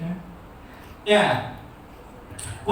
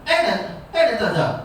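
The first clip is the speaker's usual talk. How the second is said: raised Arabic intonation; faster than usual. Shown as a demonstration. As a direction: lift "Aina" sharply in an Arabic tune. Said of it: "Ngomongnya diangkat, dinaikkan".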